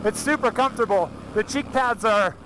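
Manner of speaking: talking inside a helmet; muffled in helmet. Real, not acted. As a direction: speak through a helmet mic, muffled. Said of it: "we actually put a mic in the helmet".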